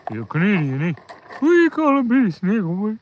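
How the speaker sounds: high, hammed-up Canadian accent; exaggerated Canadian singsong; Molly. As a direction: imitate a friend's strong Canadian accent, pitching it up. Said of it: "Just like that. That's exactly what she sounded like".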